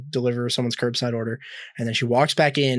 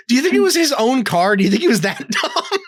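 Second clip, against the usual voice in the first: incredulous; 10 semitones higher, 5 dB louder than usual. Real, not acted. Incredulous laughing question.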